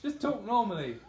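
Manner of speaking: high, loud, exasperated rant. Real, not acted. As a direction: scold the brand in disbelief.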